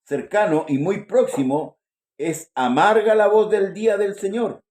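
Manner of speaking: loud, slow, declaimed Bible verse; scripture reading. Acted. Reader proclaims the day of the Lord to church, solemn scripture reading. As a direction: proclaim the verse slowly and forcefully.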